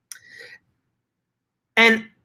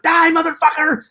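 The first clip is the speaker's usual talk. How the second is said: high mock battle cry; movie villain yell; 11 semitones higher than usual. Hero yelling at the truck, high mock battle cry. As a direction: yell it like the hero firing the launcher. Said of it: "and he's like".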